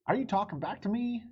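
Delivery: high, loud stern policeman. Acted. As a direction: scold her like a strict officer. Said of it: "he asked sternly".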